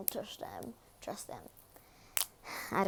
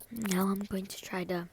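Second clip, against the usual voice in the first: whispered snack intro; ASMR whisper. Acted. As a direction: whisper it close to the mic.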